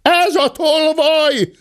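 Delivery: high, drawn-out accusation; maid's cry. A narrator's reading. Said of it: "kiáltotta a szobalány".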